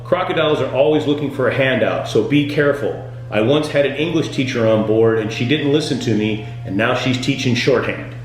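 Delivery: usual speaking voice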